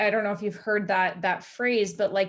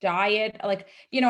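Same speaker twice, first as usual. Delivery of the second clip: ordinary talk, nothing marked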